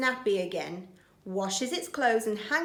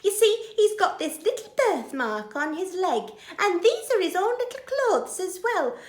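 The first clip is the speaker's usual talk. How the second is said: excited mother; 10 semitones higher, 5 dB louder than usual. Acted. Betty explains excitedly.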